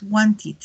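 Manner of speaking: "wanted" with its ending as a separate "it" syllable. Shown as a demonstration. Teacher shows students the past of want, "wanted" ending in "it". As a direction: sound the final syllable of "wanted" like "it". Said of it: "la pronunciación es como un it".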